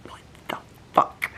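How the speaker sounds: hushed shocked whisper. Retold or imitated. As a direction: breathe it out in disbelief. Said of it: "there always comes a part where you're like".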